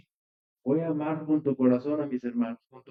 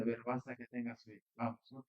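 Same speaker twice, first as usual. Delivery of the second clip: much softer, gently telling a grieving father to have faith; quiet reassurance; 3 semitones lower, 13 dB quieter than usual. Retold or imitated. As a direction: murmur it close, to comfort him. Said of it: "se lo dice a él en quedito".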